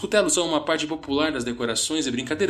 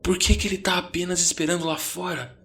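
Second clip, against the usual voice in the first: raised, anxious questioning; fretting, higher voice; 7 semitones higher than usual. A narrator's reading. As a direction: voice an anxious friend, pitched up. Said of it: "Ele me disse ansiosamente".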